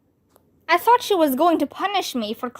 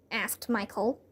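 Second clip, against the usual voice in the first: ordinary delivery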